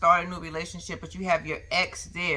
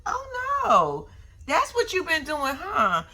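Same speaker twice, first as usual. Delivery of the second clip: mock teasing; 11 semitones higher, slower than usual. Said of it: "I said".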